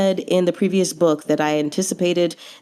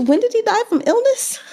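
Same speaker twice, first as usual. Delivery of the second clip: exasperated disbelief. Real, not acted. Disbelief about death cause.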